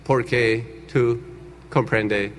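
put-on low, halting American-accented Spanish; bad-Spanish translator. Translator failing at Spanish, slow halting American accent. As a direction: struggle word by word in an American accent. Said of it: "ni siquiera hablaba bien español".

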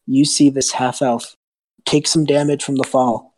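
ordinary talk

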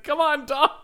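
high, laughing call to a pet; playful high-pitched beckoning; mock calling a dog. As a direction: imitate an owner summoning a dog. Voices owner calling the dog.

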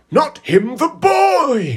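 high, loud villain shriek; Tom Riddle. Acted. Retelling the villain ordering his basilisk, shouted high. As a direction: yell it in panicked command. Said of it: "Tom Riddle's like".

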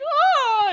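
high, loud hammed-up shriek; mock wail. Podcaster imitating Dana Delany's stagey outcry, hammed-up shriek. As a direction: let out a theatrical cry. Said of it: "she's like".

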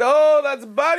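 high-pitched comic British gentleman; British accent. Retold or imitated. Two English gentlemen talking in the bar, hammed-up British accent. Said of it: "He puts on this British accent".

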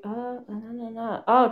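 normal talking voice

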